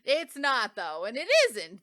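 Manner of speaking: loud emphatic agreement. Real, not acted. Agreeing with her co-host, a loud emphatic insistence. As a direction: really insist that it isn't, out loud.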